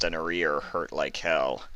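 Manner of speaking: usual speaking voice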